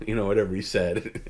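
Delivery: usual speaking voice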